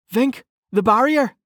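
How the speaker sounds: high-pitched warning to a colleague; female officer. A narrator's reading. Female officer warning a colleague about flames, high-voiced. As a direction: caution him in a high character voice. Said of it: "Officer Badass said".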